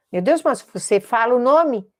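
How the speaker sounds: normal talking voice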